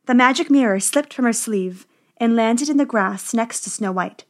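ordinary delivery

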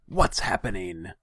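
sharp, high imperious question; demanding. A narrator's reading. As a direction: demand an answer as the ruler. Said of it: "the Khan demanded".

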